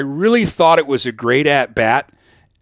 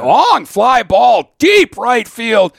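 loud, slow, soaring play-by-play call; home run call; 9 semitones higher, 5 dB louder, much slower than usual. Retold or imitated. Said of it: "I'm in my home run call".

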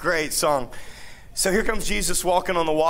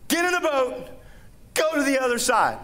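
mock high command; 8 semitones higher than usual. Voicing Jesus's simple command.

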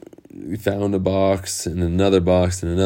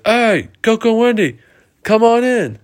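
high, loud welcoming holler; calling out; 4 dB louder than usual. Acted. Lost Boy popped out a window calling friends, high shout.

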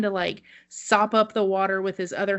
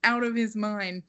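stunned inner reaction; 4 semitones higher, 3 dB quieter than usual. Retold or imitated. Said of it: "that's my reaction".

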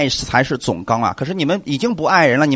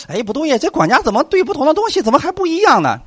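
puzzled objection; 11 semitones higher than usual. Retold or imitated. Preacher voices puzzled objectors.

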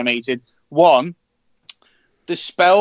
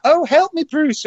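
much higher damsel-in-distress voice; mock bimbo; 11 semitones higher than usual. Mocking a character played like a bimbo, high bimbo voice. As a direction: put on a high, helpless bimbo. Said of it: "played like a bimbo or anything like that".

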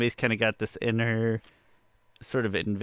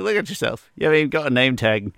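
higher, louder mock British accent; Michael Caine; 4 semitones higher, 6 dB louder, faster than usual. Fan re-enacting a scolding of bad guys, poor British accent. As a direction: punch out the film line in a poor British accent. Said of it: "Michael Caine's fighting off bad guys".

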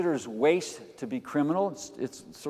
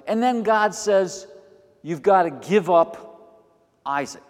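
loud, high preaching build; full-force preaching; 5 semitones higher, 7 dB louder than usual. Acted. Preacher thundering to congregation about Abraham's sacrifice. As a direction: proclaim it to the congregation.

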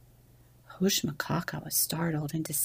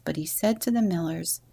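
usual speaking voice

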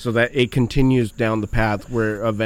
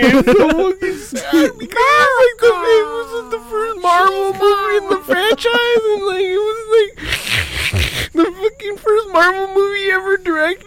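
very high, squealing mock sobs; hammed-up weeping, voice way up high; crying. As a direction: bawl the whole explanation. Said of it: "crying, whatever".

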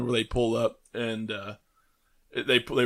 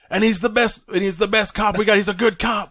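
high, loud mock insistence; insistent movie quote; 10 semitones higher, 4 dB louder than usual. Acting out the cop's defender for co-hosts, high insistent imitation. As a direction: imitate someone vouching hard, voice pitched way up.

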